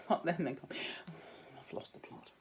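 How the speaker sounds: breathy laughing whisper, very slow; a giggling whisper at her own silliness. Real, not acted. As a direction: laugh it out under your breath. Laughing aside after joke.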